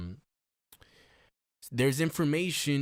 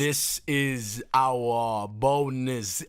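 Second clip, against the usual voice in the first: slow, put-on posh British host voice; mock British accent; much slower than usual. Podcast host opening the bonus episode, in mock British accent. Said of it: "we will be talking like this the whole time".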